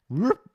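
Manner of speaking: short, swooping whoop upward; imitated whoop. Shown as a demonstration. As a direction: mimic an edge springing up. Imitating screen edge lifting.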